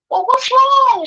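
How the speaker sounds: very high, pleading question; exasperated worry. Retold or imitated. Mother still pressing her crying daughter, worried and exasperated. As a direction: voice shoots up, desperate to know.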